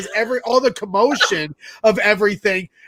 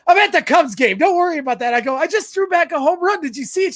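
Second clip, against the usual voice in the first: excited; 9 semitones higher, faster than usual. Retold or imitated. Calling girlfriend, thrilled after catch.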